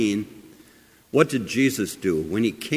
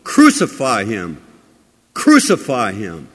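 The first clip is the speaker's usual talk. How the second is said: loud, emphatic cry quoting a crowd; preacher imitating a shouting mob; 7 dB louder than usual. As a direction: call it out like the crowd, punched hard and falling. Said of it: "would not the same crowds ... be shouting".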